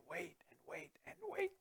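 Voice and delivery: whispered wait and wait. Real, not acted. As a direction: hush it like the long night watch. Watchmen waiting, whispered.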